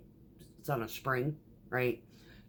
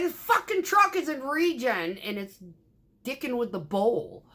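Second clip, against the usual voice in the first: exasperated retort; 7 semitones higher than usual. Retold or imitated. Retelling her frustrated answer.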